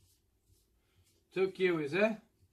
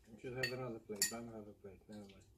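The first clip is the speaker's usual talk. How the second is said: very soft, low mumbled aside; quiet aside; 9 semitones lower, 16 dB quieter, faster than usual. Real, not acted. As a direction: drop right down, as if to yourself. Off-hand aside about a missing plate.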